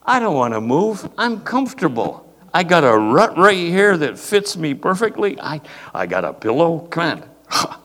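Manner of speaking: high, loud whiny sheep who won't budge; stubborn sheep. Retold or imitated. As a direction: play a lazy sheep complaining it won't move. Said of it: "We go".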